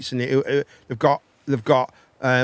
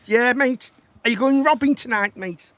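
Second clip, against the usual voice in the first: put-on Scouse accent; 7 semitones higher than usual. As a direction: ham up a cheeky Scouse lad. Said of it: "that's the accent I like".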